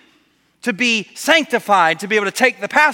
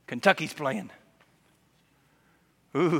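ordinary talk, nothing marked

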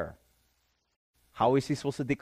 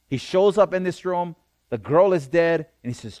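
loud, raised preaching voice; forceful preaching; 4 semitones higher, 6 dB louder than usual. Real, not acted. Preacher tells youth God decides life and death, forceful preaching. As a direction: drive each phrase home with mounting conviction.

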